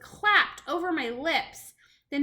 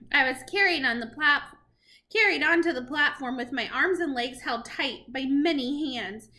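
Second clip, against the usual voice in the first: ordinary delivery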